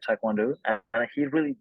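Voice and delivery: ordinary delivery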